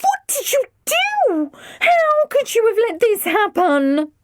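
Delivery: high tearful wail; distraught. A narrator's reading. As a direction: cry out in despair. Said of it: "so distraught".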